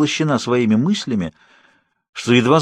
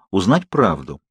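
plain reading voice

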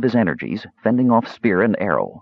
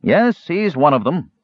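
innkeeper voice; 7 semitones higher than usual. A narrator's reading. Proprietor voiced.

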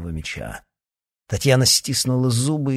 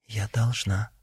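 quiet; 5 dB quieter, slower than usual. Acted. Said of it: "тихо произнесла она".

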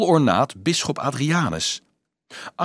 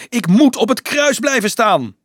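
high, loud desperate yell; screaming; 11 semitones higher, 7 dB louder than usual. A narrator's reading. Dolf dragged from the cross, screaming desperately. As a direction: scream it while being dragged off. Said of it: "gilde hij".

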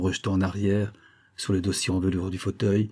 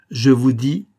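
loud, higher, clear cry; strong ringing voice; 5 semitones higher, 8 dB louder, slower than usual. A narrator's reading. Olivia confronting the major, strong ringing cry. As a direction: declare it forcefully and clearly. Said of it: "s'écria Olivia de sa voix forte, claire et vibrante".

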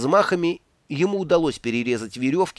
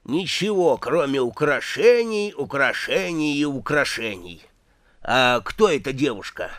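put-on very old man. A narrator's reading. Old dwarf keeps talking.

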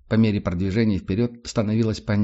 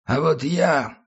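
put-on drunk voice; 6 semitones higher, much slower than usual. Tramp keeps talking drunk.

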